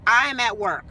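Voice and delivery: ordinary talk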